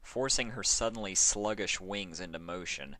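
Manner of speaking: usual speaking voice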